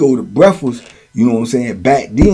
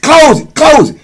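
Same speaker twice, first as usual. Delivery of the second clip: high, loud retold command; acted urgent order to shut up the cell; urgent shout; 13 semitones higher, 6 dB louder than usual. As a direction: call it out sharply, much higher.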